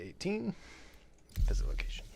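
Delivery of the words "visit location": whispering. Real, not acted. Quietly reading on-screen text.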